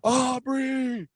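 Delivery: high, drawn-out holler from outside; imitated bully's yell. Bully outside the house calls the boy out, mock holler. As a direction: call him out like a movie warrior. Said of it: "I hear this voice".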